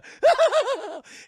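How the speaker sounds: high, loud villain cackle; evil laugh. Retold or imitated. Villain laughs after threat.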